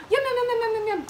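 high, rapid-fire yums; squeaky nibbling chatter; munching mouse. Acted. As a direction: gobble like a tiny mouse.

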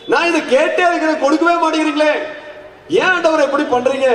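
high-pitched mock grumbling prayer; aggrieved complaint. Worshipper asks God why requests go unanswered, aggrieved complaint.

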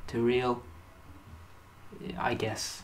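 soft, grave trailer voice; mock-grim documentary narration; doom narration. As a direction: narrate the downfall like an epic tragedy.

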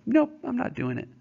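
high, stubborn "Nope"; teacher voicing Buck refusing Francois, high defiant voice. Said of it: "He's just like".

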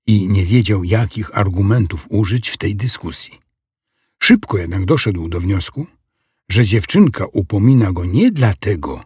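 plain reading voice

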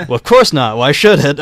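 brave lie. Acted. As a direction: cover the shock with bold nonchalance. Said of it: "I lied bravely".

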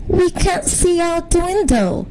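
put-on high-pitched kid complaint; high, whiny-kid protest to Dad; grumbling little girl. A narrator's reading. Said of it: "Jenny grumbled".

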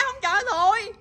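high, sulky pouting tone; mock sulk. Real, not acted. To the sulky pet, high mock pout. Repeats the pet refusal line.

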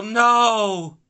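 loud, high cry of dismay; real gut-punch no; frustrated shout. Real, not acted. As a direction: shout it as the shot goes wrong.

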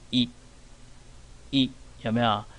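一 said short and clipped, a quick short i vowel. Shown as a demonstration. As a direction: keep 一 very short, like the vowel in thin. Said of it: "念短一点". (短促地念"一")

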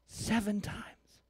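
slow whispered "Seven times"; whispered echo. Real, not acted. Pastor whispering "Seven times" back to church.

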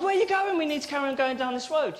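alarmed protest. Acted. Driver protests Jesus turning left.

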